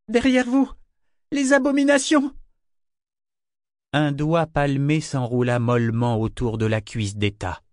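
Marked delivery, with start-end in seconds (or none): 0.1-2.3 high-pitched, alarmed shout; cried warning. A narrator's reading. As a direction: cry out to warn them of monsters. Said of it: "reprit péniblement son souffle et s'écria". (cri d'alarme aigu)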